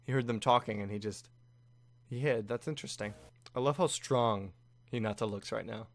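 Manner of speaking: normal talking voice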